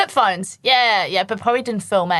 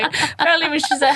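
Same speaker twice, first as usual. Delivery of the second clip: ordinary talk, nothing marked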